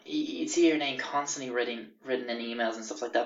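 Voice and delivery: ordinary talk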